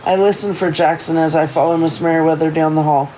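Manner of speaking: plain reading voice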